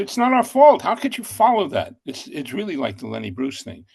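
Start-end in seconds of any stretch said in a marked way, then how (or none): 0.0-2.0 exasperated protest. Retold or imitated. Producers excuse the bomb.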